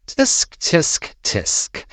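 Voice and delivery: mocking tongue clicks; tut-tut disapprovingly, breathy; tsk tsk. Bully mocking before robbing.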